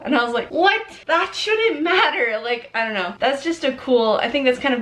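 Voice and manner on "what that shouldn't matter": high-pitched baffled "what", then drawn-out protest; puzzled, higher and stretched out; mock disbelief. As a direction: react in exaggerated confusion, pitched well up. Acted reaction to own slip.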